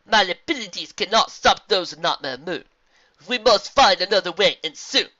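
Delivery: solemn zebra. Acted. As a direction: pronounce it with grave authority. Zebra answers gravely.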